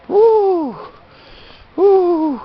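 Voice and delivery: high, loud whoops; excited whoops. Real, not acted. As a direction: whoop with joy. Excited cry in the snow.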